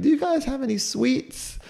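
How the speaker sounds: put-on high, slow little old lady voice; sweet old lady. Hospital neighbour asking for sweets, slow and high. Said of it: "she's like".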